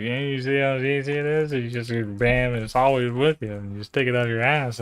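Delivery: loud, brash mock pitchman; mock sales pitch. Streamer loudly mocking the software in a smug pitchman voice. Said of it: "I was just like".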